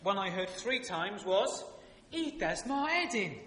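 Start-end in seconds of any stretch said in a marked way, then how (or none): 2.1-3.4 high, loud, drawn-out London moan; hammed-up London soap exasperation; London accent. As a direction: drop the H, EastEnders London style. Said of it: "I'll do the accent".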